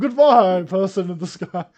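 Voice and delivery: loud call to the sky. Pretend. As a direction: shout it up into the air. Calling out goodbye to the sky.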